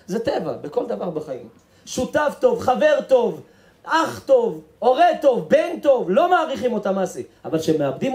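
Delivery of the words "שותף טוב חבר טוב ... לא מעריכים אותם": high, loud preacher's lament; raised, insistent sermon voice; impassioned preaching. Acted. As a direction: drive it home from the pulpit. Preaches at full force.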